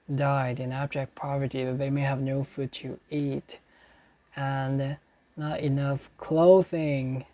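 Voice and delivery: usual speaking voice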